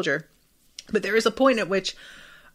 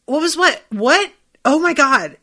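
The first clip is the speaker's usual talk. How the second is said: flustered, higher and louder; caught off guard; 6 semitones higher, 6 dB louder than usual. Retold or imitated. Someone caught dodging, flustered surprised outburst. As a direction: play dumb in a startled rush. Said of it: "And you're just like".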